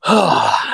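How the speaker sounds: loud, heavy exhale; long falling sigh of exasperation; exasperated sigh. Real, not acted. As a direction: let out a big fed-up sigh. Fed up, sighs before complaining.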